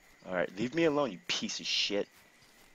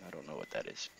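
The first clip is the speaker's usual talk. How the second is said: usual speaking voice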